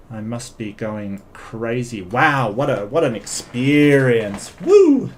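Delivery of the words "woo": excited whoop. Real, not acted. Cheering after the debate ends.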